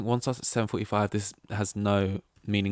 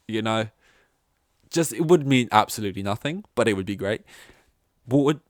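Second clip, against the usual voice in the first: normal talking voice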